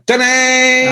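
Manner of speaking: high ringing shout of a guitar chord; guitar crash shout. Retold or imitated. Rock writers answering the phone, high shouted guitar-crash noise. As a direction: belt it like a power chord. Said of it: "we used to go".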